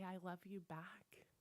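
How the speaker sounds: soft, slow, near-whispered answer; tender reply. Acted. Voices a loved one answering.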